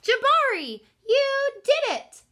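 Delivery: dad cheering son's jump; proud cheering. Acted. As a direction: cheer him on with pride.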